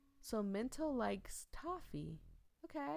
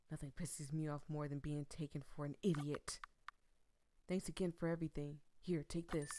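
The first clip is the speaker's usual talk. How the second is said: normal talking voice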